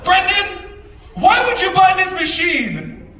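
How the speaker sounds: high, loud, drawn-out dad's rebuke; scolding dad. Retold or imitated. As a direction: imitate an exasperated dad scolding his son. Acting out dad scolding him over machine.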